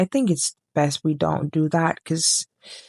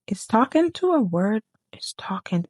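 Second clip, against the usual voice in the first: whispering; 4 semitones higher than usual. Real, not acted. Whispered aside, checking a word.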